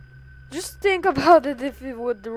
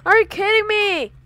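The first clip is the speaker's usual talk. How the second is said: shout; 9 semitones higher, 9 dB louder, faster than usual. Real, not acted. Jumpscared in horror game.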